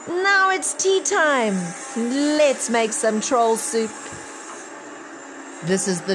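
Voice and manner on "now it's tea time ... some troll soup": much higher, slow gleeful call; gleeful yell. A narrator's reading. Children yelling at the lifted troll, gleeful. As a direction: sing it out slowly like a triumphant cheer. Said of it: "they yell".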